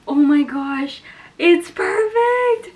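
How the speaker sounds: loud delight rising to a high squeal; exclaim, then stretch it into a high squeal. Real, not acted. Reader unboxing a perfect replacement book, delighted squeal.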